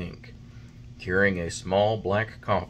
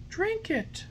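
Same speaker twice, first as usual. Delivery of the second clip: high, soft fairy voice; fairy, firm; 4 dB quieter than usual. A narrator's reading. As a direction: gently insist.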